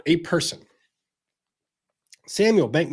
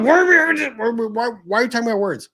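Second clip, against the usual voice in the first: much higher, louder cry of disbelief; exasperated shout; 10 semitones higher, 7 dB louder, faster than usual. Real, not acted. Ranting at viewers about priorities, exasperated shout. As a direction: blurt it out in exasperation.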